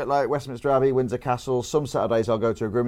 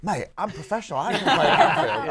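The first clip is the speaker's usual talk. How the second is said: quoting a pro footballer; 6 semitones higher than usual. Retold or imitated. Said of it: "He's like".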